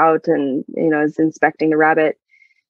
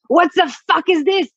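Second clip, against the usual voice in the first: put-on yelled in French accent; 11 semitones higher than usual. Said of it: "I just yelled in my accent".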